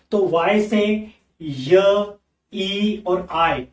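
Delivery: य, ई and आई drawn out very slowly; slow teacher demo of the three वाई sounds; sounds spelled out. Shown as a demonstration. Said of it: "ये तीनों sound generate होते हैं".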